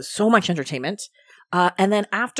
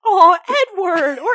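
high, loud swooning cry through laughter; mock gleeful gushing; 8 semitones higher, 11 dB louder than usual. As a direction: gush it hammed-up and giggly. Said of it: "he said it with such glee".

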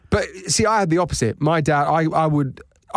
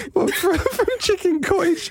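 laughing. Real, not acted. Laughing at roof jumps.

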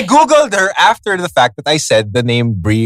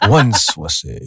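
lower, put-on narrator voice; impression; 8 semitones lower, slower than usual. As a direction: mimic a documentary narrator. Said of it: "have even more fun with the impressions".

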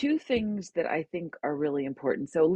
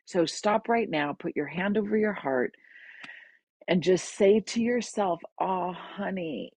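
soothing friend; 5 semitones higher than usual. Retold or imitated. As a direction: soothe a crying friend gently. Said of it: "She said".

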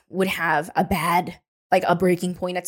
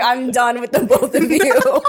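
laughing exasperation; 4 semitones higher, 4 dB louder than usual. Retold or imitated. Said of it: "He was like".

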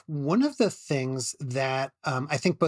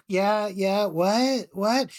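sleepy consular guy; 4 semitones higher, much slower than usual. Retold or imitated. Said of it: "some sleepy consular guy".

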